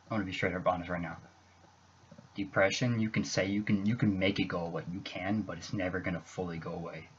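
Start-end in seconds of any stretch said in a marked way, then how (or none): none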